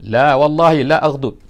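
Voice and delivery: loud, slow, defiant refusal; stubborn refusal. Retold or imitated. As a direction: refuse flatly and loudly. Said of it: "degil kan Arabi". (enggan dengan degil dan kuat)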